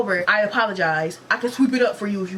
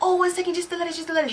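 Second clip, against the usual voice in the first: higher, quick apologetic coworker; 8 semitones higher, faster than usual. Retold or imitated. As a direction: brush him off lightly, pitched up. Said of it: "she's like".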